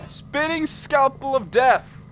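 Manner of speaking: slow, high, loud mock-epic announcement; childish epic. Gamer names his scalpel childishly, slow loud mock-epic. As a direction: boom it out like a silly hero naming a weapon. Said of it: "I'm being way too childish".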